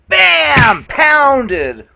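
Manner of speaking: a sudden loud high bam for the blow; mock impact shout. Telling how the fist hit, shouted bam. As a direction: slam the word out like a fist landing. Describing being punched.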